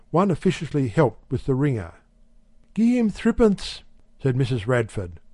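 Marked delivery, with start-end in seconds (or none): 2.7-3.8 lightly higher wife's voice; a hint of a brisk housewife; Mrs Radford's voice. A narrator's reading. Wife telling the shopkeeper, lightly higher housewife voice.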